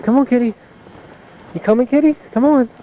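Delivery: high, loud pet call; calling the cat. Real, not acted. Calling the cat through snow.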